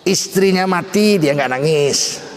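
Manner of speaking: ordinary talk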